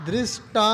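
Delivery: दृष्टा with a clear "dri" at the start; correct pronunciation. Shown as a demonstration. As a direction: model दृष्टा as "drish-taa" for pupils. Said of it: "डीआरआई है ना?".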